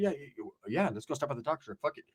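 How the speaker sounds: very soft, stammered agreement; sheepish giving in. Retold or imitated. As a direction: cave in under your breath. Retold giving in to the taco stop.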